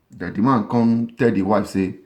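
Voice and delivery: ordinary talk, nothing marked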